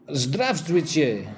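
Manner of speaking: slow Russian "Здравствуйте"; Russian greeting. Shown as a demonstration. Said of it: "Hello from Russia".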